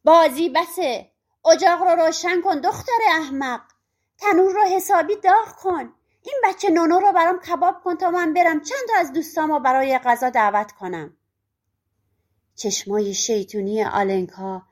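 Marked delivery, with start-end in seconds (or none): 0.0-11.2 loud, higher barked orders; witch shouting. Acted. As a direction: yell orders at the daughter. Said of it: "جادوگر فریاد کشید".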